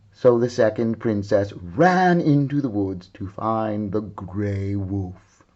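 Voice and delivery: usual speaking voice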